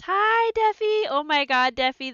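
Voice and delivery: loud, high excited hello; excited greeting. Real, not acted. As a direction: greet a friend with delight. Greeting a viewer in chat.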